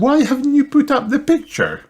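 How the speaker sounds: high-pitched cartoon mother; high, questioning character voice; Mummy Pig. A narrator's reading.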